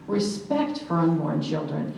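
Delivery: ordinary talk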